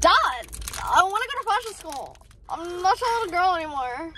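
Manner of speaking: put-on high pleading teenage girl; whiny daughter. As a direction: beg dad like a bratty teen. Mock teen daughter pleading.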